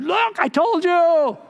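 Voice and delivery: high, drawn-out exultant call; triumphant cry. Retold or imitated. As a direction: crow it out to the crowd, stretching the words. Pastor's triumphant cry to people.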